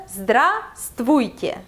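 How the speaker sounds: Здравствуйте in two slow parts, "zdra", then "stvuite"; joining syllables. Shown as a demonstration. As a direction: slowly sound out Здравствуйте with a break after "zdra". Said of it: "let's combine them together".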